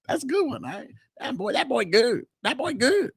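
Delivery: high-pitched, playful approval; amused praise. Retold or imitated. Host replays his reply to a commenter, amused and high-pitched. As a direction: pitch it way up, tickled by the joke.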